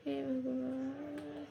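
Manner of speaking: soft, slow startled gasp of "हे भगवान". Real, not acted. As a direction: caught off guard, breathe it out softly. Teacher startled, interrupted mid-lesson.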